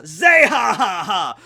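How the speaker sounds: big, loud cartoon villain laugh; Blackbeard's evil laugh. Fan imitates a pirate villain's laugh, loud evil cackle. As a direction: boom out each ha like a villain. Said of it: "Blackbeard's laugh is".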